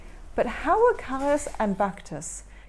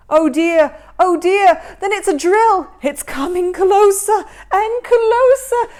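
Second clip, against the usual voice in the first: much higher and louder panicked yelling; panicked shout; 8 semitones higher, 13 dB louder than usual. Acted. Baktus warning his brother of the drill, panicked shout. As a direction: scream as the drill comes closer. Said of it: "shouted Baktus".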